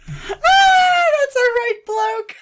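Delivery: very high, loud squeal; shrieking with laughter. Real, not acted. Commentator reacting to a photo, shrieking with laughter. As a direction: squeal it through a real laughing fit.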